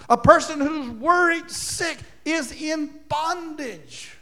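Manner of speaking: high, slow, fervent preaching; preaching fervor. Real, not acted. Preacher rising to a climax before the congregation, fervent. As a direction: drive home the bondage.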